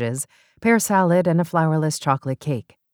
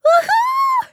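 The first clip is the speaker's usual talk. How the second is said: very high, loud whoop; joyful cry; 7 dB louder than usual. Acted. Beth is named matron of honor, whooping with joy. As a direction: whoop with delight. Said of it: "Beth cried".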